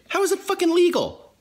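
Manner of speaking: high, exasperated cry of disbelief; indignant outburst. Real, not acted. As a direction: yelp it in outrage at the mail carrier. Mail left outside, ranting.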